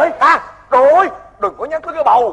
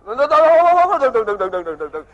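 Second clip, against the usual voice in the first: long wail sliding down into repeats; stammered pleading that tapers off; frantic stalling; slower than usual. Acted. Father stops questioning of fake daughter.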